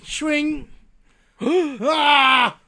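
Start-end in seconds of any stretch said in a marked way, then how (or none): 1.4-2.6 sharp cry of pain; pained shout. Pretend. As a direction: yelp as if struck. Reacting to sword swing.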